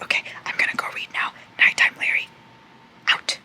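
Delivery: quick whispered sign-off; fast whisper, wrapping up. Real, not acted. Signing off to viewers at night, fast whisper. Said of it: "I can't talk that loud".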